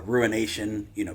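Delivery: ordinary delivery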